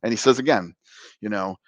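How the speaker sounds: ordinary delivery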